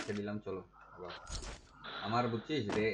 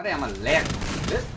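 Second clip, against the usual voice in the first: frustrated shout; 4 semitones higher, 9 dB louder, faster than usual. Real, not acted. Frustrated by game lag.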